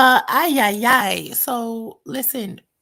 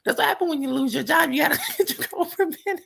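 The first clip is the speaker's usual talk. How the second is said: laughing; 5 semitones higher, faster than usual. Real, not acted. Joking about lost job benefits.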